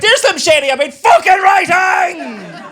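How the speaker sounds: laughing yell of pride; excited shout. Retold or imitated. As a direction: blurt it out laughing and loud. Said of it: "He was like".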